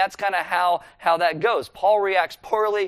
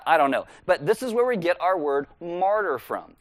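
normal talking voice

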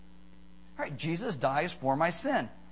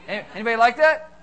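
loud fast call; 4 semitones higher, 9 dB louder, faster than usual. Real, not acted. Pastor asks the ladies.

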